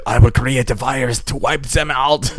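hammed-up German evil genius; German accent. Joker voicing an evil genius vowing revenge, comic German accent. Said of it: "Why were they German?".

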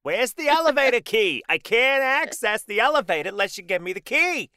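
high, loud shouting Murray voice. Acted. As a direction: yell for Murray in a shrill put-on voice. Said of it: "every time you do that voice".

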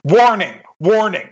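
loud alarm announcement; robotic alarm voice. Acted. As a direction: blare out like a security system. Security alarm going off.

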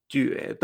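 "juede" rising, then short and toneless; second tone and unstressed tail on "juede"; two plus neutral. Shown as a demonstration. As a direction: lift "jue", let "de" drop lightly. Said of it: "el tono dos y el tono neutro".

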